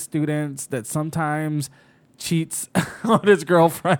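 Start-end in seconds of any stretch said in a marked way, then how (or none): 2.2-4.0 laughing. Real, not acted. Laughing while telling.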